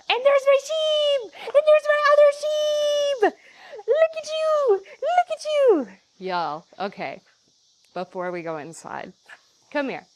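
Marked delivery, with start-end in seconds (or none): 0.1-5.9 much higher, squeaky sing-song and slow; squealing pet talk. Real, not acted. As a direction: coo at the animals like babies. Greeting her pets excitedly.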